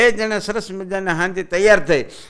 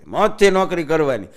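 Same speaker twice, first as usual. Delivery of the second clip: usual speaking voice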